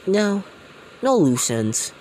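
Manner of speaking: loud, firm refusal; loud boss order. Retold or imitated. Gang boss to the dad, loud and cold. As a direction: bark the order, no mercy.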